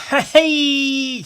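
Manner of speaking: high, loud laughing shout; giddy high shout; laughing exclamation. Real, not acted. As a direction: yelp it out through a laugh.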